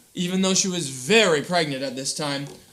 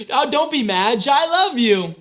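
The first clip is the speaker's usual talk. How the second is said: louder and higher, with more gusto; repeated for the children with more energy; 5 semitones higher, 6 dB louder than usual. Shown as a demonstration. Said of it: "Do it again".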